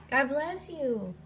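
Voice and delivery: ordinary delivery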